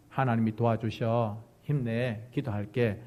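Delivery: low, soft supportive words; warm encouragement. Acted. Offering prayer to someone struggling, warm encouragement. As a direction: encourage them gently. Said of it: "이런 따뜻함들이 필요할 것".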